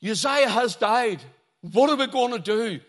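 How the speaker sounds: high, loud cry of dismay; acted alarm. Preacher voices people bereft of their king, loudly alarmed. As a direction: cry out as if all is lost. Voicing alarm at king's death.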